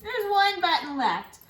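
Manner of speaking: high, loud announced answer; loud cheerful count; excited answer. Acted. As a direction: call out the answer like a reveal.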